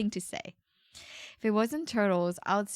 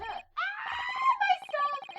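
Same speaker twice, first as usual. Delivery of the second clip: sudden high yelp; startled cry; 4 dB quieter, much slower than usual. Real, not acted. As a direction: yelp as things fall. Drops her stuff.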